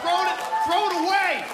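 loud, high mock yell; shouting to toss the game; mock parent yelling. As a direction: bark it like a fed-up parent.

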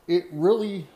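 usual speaking voice

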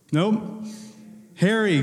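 usual speaking voice

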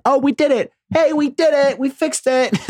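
high-pitched mock cheering; hammed-up, squeaky triumph; mock celebration. Country pretending money solved hunger, mock celebration.

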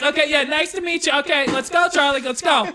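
high, anxious grandma calling; panicky grandma. Retold or imitated. Grandma pulling Charlie away from a stranger, high and panicky. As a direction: hurry the child away, high and nervous. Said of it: "And she was like".

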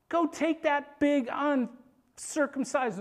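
high, slow, emphatic insult; indignant command. Acted. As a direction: act out a fired-up order. David insulting the giant, urging attack.

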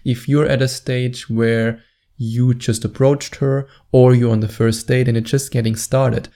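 usual speaking voice

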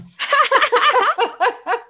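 high, loud peal of laughter; real laugh. Real, not acted. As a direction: burst out in high giggles. Laughs at Mary Poppins remark.

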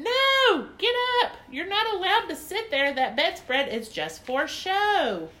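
very high, very loud bossy yell; shouting. Acted. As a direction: shriek at them to get off the bed. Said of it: "Lucille shouted at us again".

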